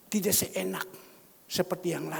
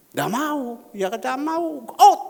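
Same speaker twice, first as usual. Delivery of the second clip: higher, loud refusal ending in a yell; 8 semitones higher, 11 dB louder, slower than usual. Retold or imitated. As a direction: refuse louder and louder, then yell out. Preacher recalls refusing the suitor. (penolakan keras yang memuncak jadi teriakan)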